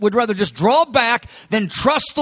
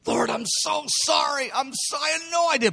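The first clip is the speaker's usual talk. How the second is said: soft, high-pitched pleading; pleading apology; 5 semitones higher, 6 dB quieter than usual. Acted. Peter apologizing to the Lord, plaintive high plea.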